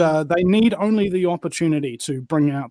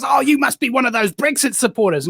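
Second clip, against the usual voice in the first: mock angry sneer; 6 semitones higher than usual. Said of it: "he got really angry with me".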